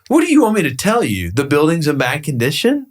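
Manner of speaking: high-pitched exasperated question; retold exasperation. Broker answering clients upset he skipped tour, exasperated.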